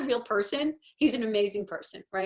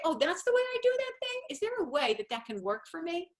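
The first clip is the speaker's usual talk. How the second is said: curious discovery; 9 semitones higher than usual. Pretend. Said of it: "then say".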